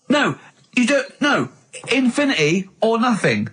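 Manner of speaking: ordinary delivery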